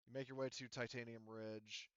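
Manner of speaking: ordinary talk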